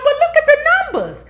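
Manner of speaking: high, loud and laughing; wife insisting. Retold or imitated. Wife pointing at the numbers, high laughing insistence. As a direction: squeal it through a laugh. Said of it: "She was like".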